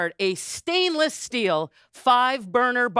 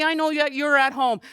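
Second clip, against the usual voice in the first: normal talking voice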